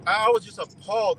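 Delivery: normal talking voice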